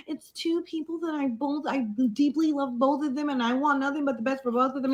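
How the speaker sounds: lightly put-on sweet, sincere statement voice; mocking fake PR voice. Mocking the girl's fake PR statement in a sugary voice. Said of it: "how she tried to come on the internet like".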